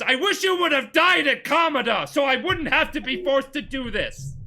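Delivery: high, loud duel shout; acted angry outburst. Betrayer shouting at his old friend mid-fight, acted angry outburst. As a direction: hurl it at an old friend, hammed-up.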